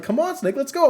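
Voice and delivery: high, eager sidekick voice calling Snake on; Otacon impression. Sidekick hurrying Snake along, high eager voice. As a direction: pitch way up and urge him along.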